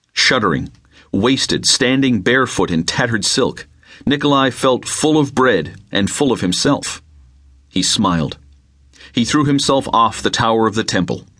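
plain reading voice

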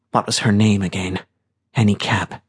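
plain reading voice

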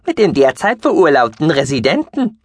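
high piping creature voice, teasing; squeaky mouse-beaver voice. Acted. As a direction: add it like a cheeky furry sidekick. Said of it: "ergänzte Guki".

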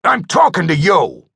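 high, loud furious yell; angry shout. A narrator's reading. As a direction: bark it in his face. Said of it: "the cyborg shouted".

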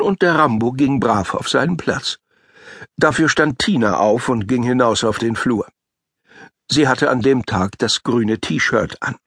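plain reading voice

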